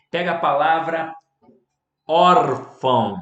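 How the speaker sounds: slow, loud órfão with the first syllable hit hard; stress on órfão. Shown as a demonstration. Teacher to students, hammering the word órfão slowly and loudly. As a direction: drag out órfão, hitting ÓR loud. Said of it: "Ela leva o acento".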